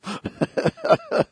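rising giggle; real rising laugh; high laugh. Real, not acted. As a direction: keep laughing at the joke. Laughing at own joke.

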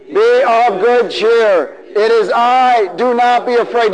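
loud, very slow proclamation; preached Jesus, loud and drawn out. Acted. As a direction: call out reassurance across the storm. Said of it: "One more time".

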